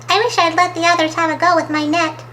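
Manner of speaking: high, quick, mildly woeful character voice; miserable. A narrator's reading. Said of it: "she thought miserably".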